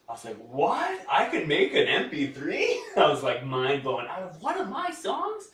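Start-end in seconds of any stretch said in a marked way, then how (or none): 0.4-2.8 higher, louder disbelieving question; amazed disbelief. Retold or imitated. Retold amazed reaction. 4.4-5.5 very high, squeaky excited voice; giddy disbelief. Retold or imitated. Retold amazed reaction.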